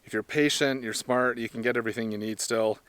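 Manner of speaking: normal talking voice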